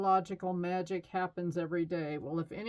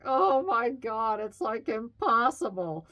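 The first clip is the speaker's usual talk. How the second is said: exasperated Libra; 4 semitones higher, 5 dB louder than usual. Retold or imitated. Mimics an indecisive Libra.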